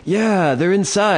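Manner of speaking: high, slow, wailing fake dismay; hammed-up dazed drunk. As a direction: drag it out like a drunk faking panic. Drunk guy insisting.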